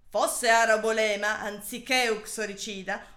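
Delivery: ordinary delivery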